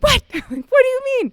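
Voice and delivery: high, loud, disbelieving outburst; incredulous. Retold or imitated. Wife hears husband is giving up, incredulous. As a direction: demand an explanation, voice shooting up. Mom hears dad is coming home.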